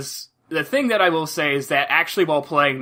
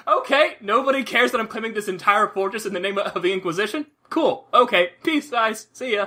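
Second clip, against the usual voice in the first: higher, breezy mock-innocent cheer; mock casual; 8 semitones higher than usual. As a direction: play it like strolling off without a care. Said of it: "And I'm like".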